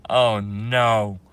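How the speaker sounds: usual speaking voice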